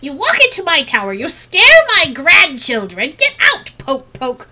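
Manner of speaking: high, loud, outraged crone; indignant old lady driving someone off; old woman's tirade. Acted. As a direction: scold the intruder as a cranky granny, building to a yell. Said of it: "hear an old woman's voice".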